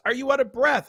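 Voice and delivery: high, chirpy nurse question; bright mock nurse asking. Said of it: "She goes".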